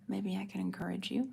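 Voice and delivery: ordinary delivery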